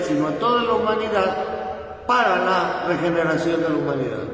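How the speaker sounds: loud, high, driving sermon voice; fervent preaching. Acted. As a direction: preach it at full force to the congregation. Priest preaching with rising force.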